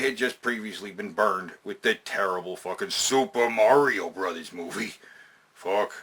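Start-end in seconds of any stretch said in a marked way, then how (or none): none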